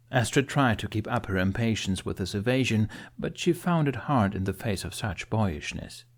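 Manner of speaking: ordinary delivery, nothing marked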